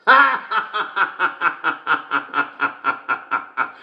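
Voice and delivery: high, drawn-out delighted laugh; long laugh. Real, not acted. Host laughing long at the best joke of the day.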